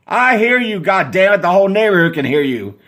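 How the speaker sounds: high, loud irritated yell; yelling back. Acted. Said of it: "you yell back".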